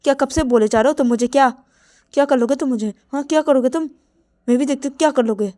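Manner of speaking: loud, high challenging taunt; Ravi taunting. Acted. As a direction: dare him to do something. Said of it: "तो तभी रवि बोलते".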